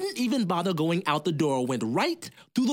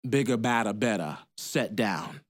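ordinary delivery

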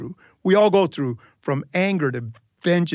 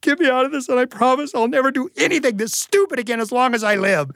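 panicked prayer; 7 semitones higher than usual. Retold or imitated. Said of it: "I start to pray".

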